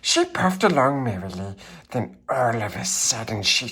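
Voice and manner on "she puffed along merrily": ordinary delivery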